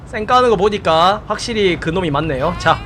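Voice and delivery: ordinary delivery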